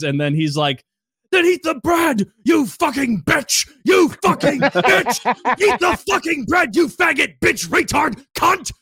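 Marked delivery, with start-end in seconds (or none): 1.3-5.6 very high, drawn-out mock shouting; retold unhinged screaming. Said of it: "He's losing his mind". 5.6-8.7 high-pitched mock tirade, losing his mind; imitated screaming. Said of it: "screaming at this girl".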